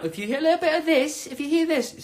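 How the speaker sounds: high, put-on English accent. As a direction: show off a mock English accent. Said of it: "That accent is so".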